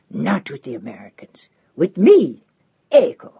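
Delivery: whispered With me, then a firm Ecco; old housekeeper insisting the girl stay with her; fierce whisper. A narrator's reading. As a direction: hiss it urgently, then land Ecco. Old woman insisting warmly.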